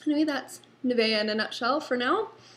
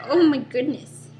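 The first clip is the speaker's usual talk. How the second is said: delighted surprise; 5 semitones higher, 10 dB louder than usual. Real, not acted. Delighted reaction to her baby.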